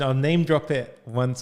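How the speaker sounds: ordinary talk, nothing marked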